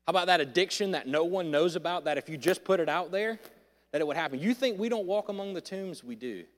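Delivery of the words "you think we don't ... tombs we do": usual speaking voice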